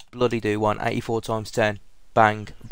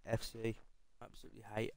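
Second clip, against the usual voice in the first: hushed, defeated disgust; stunned despair; 18 dB quieter, faster than usual. Real, not acted. Still crushed by the result.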